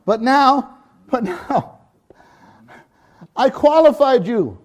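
high, loud joyful declaration; father lifting up his son; emphatic father. Acted. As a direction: proclaim it like a proud father. Preacher voicing the Father.

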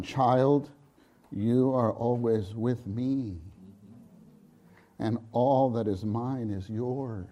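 soft, slow reassurance; father comforting his older son; tender father. Acted. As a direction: gently reassure the child.

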